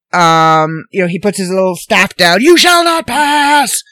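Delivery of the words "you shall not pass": slow, high mock-Gandalf shout; hammed-up wizard shout. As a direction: boom it out like the wizard on the bridge. Gandalf quote acted out.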